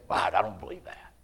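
breathy whispered wow; whispered disbelief. Retold or imitated. Answering God in disbelief, whispered. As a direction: hush it in disbelief. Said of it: "I'd say".

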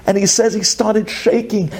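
normal talking voice

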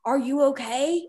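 a mock alarmed check-in; concerned husband. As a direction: lightly imitate a worried partner. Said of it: "He's like, he'll come home from work".

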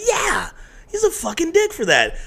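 very high, squeaky mock indignation; retold indignant agreement. Agreeing with a friend about the snub, mock-indignant and high.

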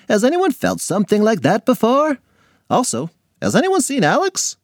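put-on a chatty teacher explaining to kids. Teacher continues the lesson.

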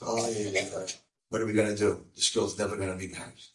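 low, soft, mildly tearful retelling; retold crying. Rabbi despairing that she'll never marry, subdued retold crying. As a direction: drop low and quiet, imitating his grief. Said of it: "like, crying".

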